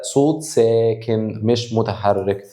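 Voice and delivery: drawn-out words at about half pace; slow, emphatic. Shown as a demonstration. Teacher concluding the U is a consonant sound, slow and deliberate. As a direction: stretch each word to hammer the rule. Teacher spells out the rule slowly.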